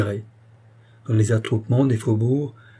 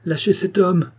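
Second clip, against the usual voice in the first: high, forceful command; crying out; 8 semitones higher, 4 dB louder than usual. A narrator's reading. Queen orders the valet to free the man, crying out. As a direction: order it in a raised, urgent voice. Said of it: "cria la reine".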